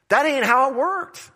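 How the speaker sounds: sudden high, emphatic retort; high punchy punchline. Real, not acted. Preacher's punchline.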